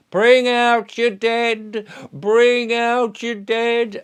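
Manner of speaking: slow, loud drawn-out plague cart cry; town crier call. Retold or imitated. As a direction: call it out slowly like a medieval corpse collector. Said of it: "somebody would be coming around with a wheelbarrow going".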